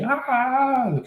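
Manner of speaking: long, high-pitched mock scream; imitated scream. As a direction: stretch out a high "ah" as a pretend scream. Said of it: "started screaming".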